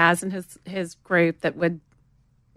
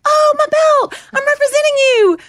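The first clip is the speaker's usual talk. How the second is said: very high, loud acted exclamation; delighted realisation; 8 dB louder than usual. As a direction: shout it as the penny drops. Retold sudden realisation.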